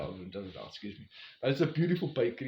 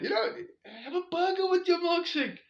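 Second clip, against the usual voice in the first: much higher playful voice; high coaxing friend; 4 dB louder than usual. Retold or imitated. Lanisha urges hiker to add a burger, high coaxing voice. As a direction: sweet-talk him into a burger.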